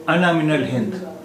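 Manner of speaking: normal talking voice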